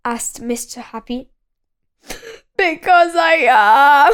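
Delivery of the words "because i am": louder, whiny and weepy; sulky miserable whine. A narrator's reading. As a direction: moan it back stubbornly. Mister Miserable answers sulkily.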